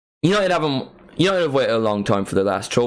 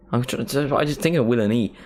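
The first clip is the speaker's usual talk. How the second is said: ordinary talk, nothing marked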